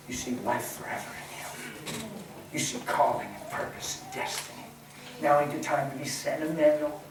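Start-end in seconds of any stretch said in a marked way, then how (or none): 0.1-4.7 soft, breathy preacher aside; hushed. Real, not acted. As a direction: drop to a near whisper.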